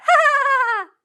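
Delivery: high, loud cackling ha ha ha; a wild animal's giddy cackle; cackling laugh. Acted. Animal friend cackles to show Jim how, high and loud cackle.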